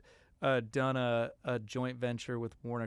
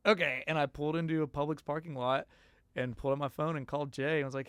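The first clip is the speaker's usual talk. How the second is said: ordinary delivery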